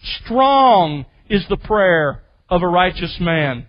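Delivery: Strong said first and held, whole line slow; emphasis on Strong. Shown as a demonstration. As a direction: stretch out Strong and take the rest slowly. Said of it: "put there for emphasis".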